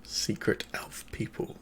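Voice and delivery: very soft, slow whisper; whispered echo. Acted. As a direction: echo it slowly under your breath. Echoes the name under breath.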